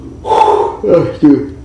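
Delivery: real, long blown-out sigh; weary-sounding sigh; heavy sigh. Real, not acted. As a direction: let out a big exhale as if drained. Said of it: "I'm not tired, that's your spirit".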